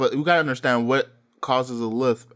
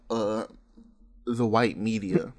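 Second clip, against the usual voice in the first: ordinary talk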